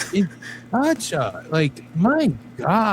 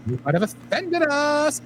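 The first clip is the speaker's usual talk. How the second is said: mock camp voice; 8 semitones higher than usual. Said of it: "You do that a little too well".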